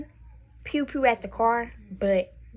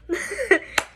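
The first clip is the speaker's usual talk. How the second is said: high-pitched burst of laughter; disbelieving laugh; 11 semitones higher than usual. Real, not acted. Laughs after asking about robbery.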